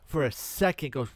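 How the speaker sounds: ordinary delivery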